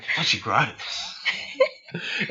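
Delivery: slow whispered aside; hushed mock dismay; voiced worried dad. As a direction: whisper it like a bewildered parent. Dad's worried aside to wife.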